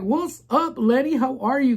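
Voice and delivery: high, bright hello to a viewer; excited greeting. Real, not acted. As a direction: greet him with a big lift.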